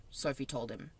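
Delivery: ordinary delivery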